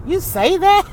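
high, incredulous mock question; disapproving mother. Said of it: "my mother used to be like".

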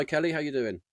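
usual speaking voice

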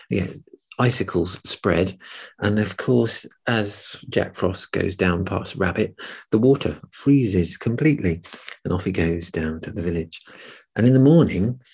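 ordinary delivery

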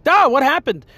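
put-on high, confused little kid's voice; dazed and scared. Eight-year-old after falling off recliner, dazed and scared. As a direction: imitate a stunned child just after a fall. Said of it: "like, all scared".

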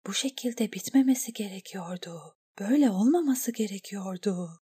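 hushed, breathless whisper of dismay; whispered inner thought. A narrator's reading. Eriyen whispers her dismay to herself, hushed. Inner despair after the betrayal.

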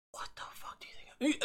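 whispered aside, unvoiced and fast. Real, not acted. Podcaster whispering a rhetorical jab to listeners. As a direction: hiss it out in a quick whisper.

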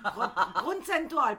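laughing through a tongue-twister; fighting back laughter. Real, not acted. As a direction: giggle while stumbling over the word. Stumbling over joke word, laughing.

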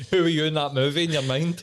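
teasing question while cracking up; laughing. Real, not acted. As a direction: tease him through laughter. Laughing after the Stand By Me gag.

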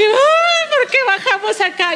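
high, half-laughing mock wail; mock crying whine. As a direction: imitate her younger self whining through a laugh. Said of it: "yo llore y llore". (queja llorona en broma, muy aguda y entre risas)

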